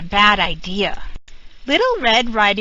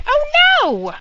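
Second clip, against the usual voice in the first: much higher cry of alarm; 4 dB louder than usual. A narrator's reading. Narrator reacting as the wolf bites, much higher cry. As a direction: gasp it out in storybook fright.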